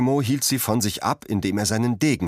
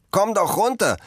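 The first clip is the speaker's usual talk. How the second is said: high, loud call up into the tree; 12 semitones higher, 3 dB louder than usual. A narrator's reading. Children calling up to Cosimo in the tree, high and loud. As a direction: shout up to the boy in the tree. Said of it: "riefen ihm die anderen zu".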